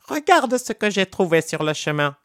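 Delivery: high, sly monkey showing off his find; high-pitched mischievous monkey; cheeky monkey voice. Acted. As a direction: gloat like a trickster monkey.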